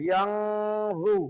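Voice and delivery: "ইয়াং" held with a nasal hum before "জু"; nasal ghunna. Shown as a demonstration. Teacher showing ghunna, "ইয়াং" nasalised and held. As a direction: hum the ng of "ইয়াং" through the nose, then "জু". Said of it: "গুণনা করে পড়তে হবে".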